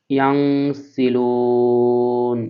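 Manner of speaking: ইয়ানসিলুন drawn out long with ghunna on the ন; nasal ghunna ending. Shown as a demonstration. Teacher shows ghunna on ইয়ানসিলুন, long and nasal. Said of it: "গুণনা করতে হবে".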